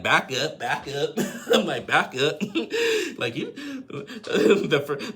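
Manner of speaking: quoting through loud laughter; helpless giggles breaking up the words; laughing. Real, not acted. Laughing as she repeats the quote.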